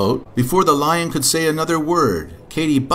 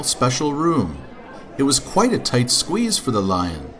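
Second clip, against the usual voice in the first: ordinary delivery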